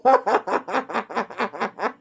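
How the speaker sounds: long staccato belly laugh; real cackling laugh; helpless laughter. Real, not acted. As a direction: laugh on and on in quick pulses. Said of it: "That shit love makes me like".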